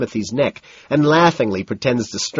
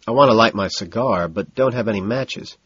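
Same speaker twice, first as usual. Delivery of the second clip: ordinary delivery, nothing marked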